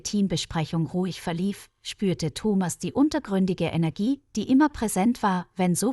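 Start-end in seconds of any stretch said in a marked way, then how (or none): none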